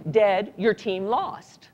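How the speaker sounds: ordinary delivery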